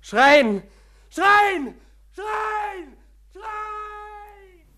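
high, very loud screams fading out; screaming. Acted. Patient screaming at his doctor, high and loud. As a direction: scream with everything. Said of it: "Ich will schreien".

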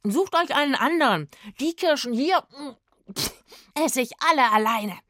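loud, high, greedy refusal; possessive shouting. A narrator's reading. Rufus spits stones and claims every cherry, possessive shouting. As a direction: order them away, words broken by munching.

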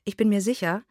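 plain reading voice

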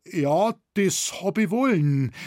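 high, slow, loud confession; contrite. A narrator's reading. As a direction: own up with a drawn-out wail. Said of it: "antwortete der Jäger mit zerknirschter Miene".

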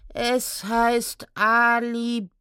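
slow, drawn-out pained correction; groaned correction. Acted. Centaur correcting the gnome, slow groan. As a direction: spell it out slowly, syllable by syllable. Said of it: "stöhnte Remus".

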